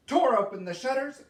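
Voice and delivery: plain reading voice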